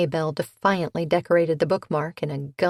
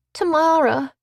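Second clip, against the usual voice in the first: put-on bright young woman; 10 semitones higher, 5 dB louder than usual. A narrator's reading. Leanna answers brightly.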